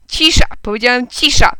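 loud, high command for silence; shouting. A narrator's reading. Headmaster silencing two quarrelling boys, shouting. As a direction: shout down the squabbling, hard and sudden. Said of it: "krzyknął".

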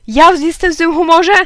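high, loud, indignant question; angry shout. A narrator's reading. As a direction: throw it back in outrage. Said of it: "krzyknął tata". (oburzony krzyk ojca)

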